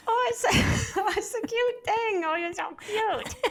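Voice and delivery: put-on very high, slow cooing baby voice; squeal-coo at something adorable, giggling; baby talk. Shown as a demonstration. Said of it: "do you ever use that voice".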